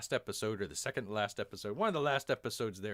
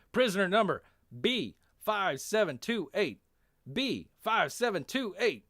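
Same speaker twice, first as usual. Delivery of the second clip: high, loud call to the workers; loud roll-call shout, each figure separate; yelling; 10 semitones higher, 8 dB louder than usual. Acted. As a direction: yell out a prisoner number across a crowd. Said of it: "yelling to the workers".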